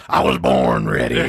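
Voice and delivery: low drawled cowboy line; deep movie cowboy. Retold or imitated. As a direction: do a gruff western hero. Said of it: "And he goes".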